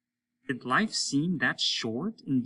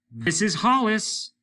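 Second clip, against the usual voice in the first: high, loud and slow, cold; mean; 10 semitones higher, 7 dB louder, slower than usual. A narrator's reading. Hollis answers Lesper meanly, slow and loud. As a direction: say your name like a threat. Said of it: "He was being mean".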